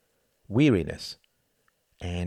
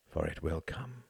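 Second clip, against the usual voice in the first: quiet inner voice; 3 semitones lower, 10 dB quieter than usual. A narrator's reading. Tom's silent thought.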